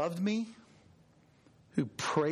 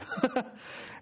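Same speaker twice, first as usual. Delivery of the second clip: short breathy chuckle; real chuckle; 7 semitones higher than usual. Real, not acted. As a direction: let out a brief, amused laugh. Laughs after Tim's forgetful reply.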